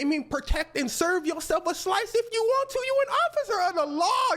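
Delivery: very high-pitched mock chief voice; high, cartoonish authority figure; hammed-up police chief. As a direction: imitate a chief egging on an officer. Acts chief egging officer on.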